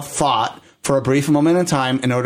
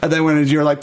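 normal talking voice

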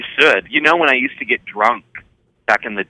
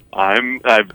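usual speaking voice

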